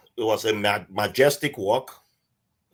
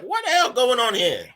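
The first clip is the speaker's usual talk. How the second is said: retold shock, pitched way up; shocked outburst; 12 semitones higher, 3 dB louder than usual. Outsider reacts to the topless procession, acted shocked outburst. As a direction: act out being scandalised and baffled. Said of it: "I'm shocked now".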